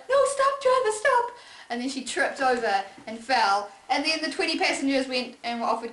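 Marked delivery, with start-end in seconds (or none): 0.1-1.3 high, loud call to the driver; high shout. Acted. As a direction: shriek it after the bus. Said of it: "so she was like".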